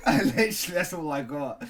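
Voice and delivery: loud, high, laughing admission. Real, not acted. Podcast host admits to co-host he has nothing, laughing. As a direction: say it through a real laugh.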